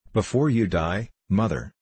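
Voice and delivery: ordinary delivery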